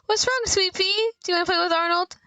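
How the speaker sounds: put-on high, cooing baby talk. To the crying baby River, high cooing comfort. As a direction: coo it to calm a crying infant.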